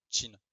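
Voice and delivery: "Qin" said as "tchin", with a French tch sound; Chinese name read aloud. Shown as a demonstration. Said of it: "un T C H en français".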